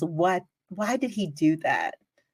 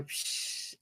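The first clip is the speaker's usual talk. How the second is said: short unvoiced "psh" spray noise; imitated peeing hiss; 19 dB quieter than usual. Storyteller shows what they heard on the right, imitated hiss. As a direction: softly mimic the sound of a stream. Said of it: "and you hear".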